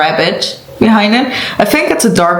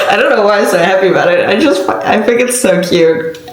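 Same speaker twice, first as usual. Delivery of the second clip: ordinary talk, nothing marked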